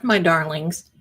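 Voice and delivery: ordinary talk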